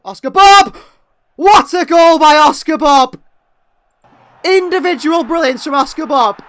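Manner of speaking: roaring, high, loud goal call; goal celebration. Real, not acted. Fan commentator calling the goal, roaring and ecstatic. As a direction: scream the goal with pure joy.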